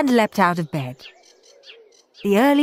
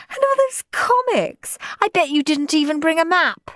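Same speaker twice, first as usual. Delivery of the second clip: mocking girl; 10 semitones higher than usual. A narrator's reading. Margaret mocking.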